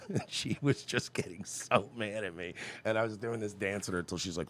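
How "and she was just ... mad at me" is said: normal talking voice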